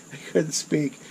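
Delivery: chuckling through the words; amused, half-laughing correction. Real, not acted. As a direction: laugh at your own slip of the tongue. Laughing at his own slip.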